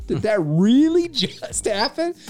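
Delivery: disbelief. Retold or imitated. Host driving off after the fist bump: high, slow, laughing disbelief. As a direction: marvel with a laugh. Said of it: "and I'm like".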